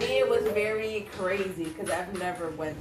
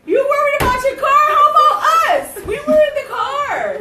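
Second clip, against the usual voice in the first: high, loud laughing scold; laughing outrage; 13 dB louder than usual. Retold or imitated. Scolding the boyfriend about the crash, loud and laughing. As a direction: yell at him through laughter.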